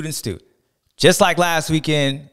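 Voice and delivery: normal talking voice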